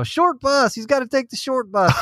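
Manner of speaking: high pitched heckling call; jeering yell, pitch way up; mock yell from the back. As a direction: shout it out like a teasing heckler. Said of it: "somebody yells out from the back".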